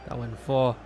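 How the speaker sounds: ordinary talk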